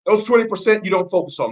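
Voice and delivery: normal talking voice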